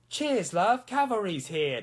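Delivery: put-on high, chirpy British accent; loud cockney-style British call; Tracer. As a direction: pitch it up, playfully British. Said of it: "British is hard".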